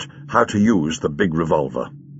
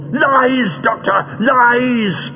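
high, frenzied villain shriek; 14 semitones higher, much slower than usual. A narrator's reading. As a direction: shriek the accusation in furious denial. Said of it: "shrieked Greel".

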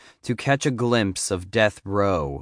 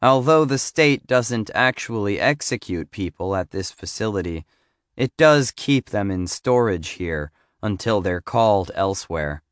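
ordinary delivery, nothing marked

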